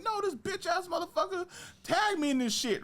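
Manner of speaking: squeaky, very high mock outrage; high-pitched comic indignation; retold indignant rant. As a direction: rant in a strained high pitch, acting out the grievance. Retelling outburst about being tagged.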